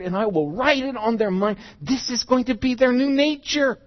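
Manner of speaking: loud, high, building declaration; rising to a ringing, emphatic promise; fervent proclamation. Acted. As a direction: preach it out at full force. Preacher declaims God's covenant promise.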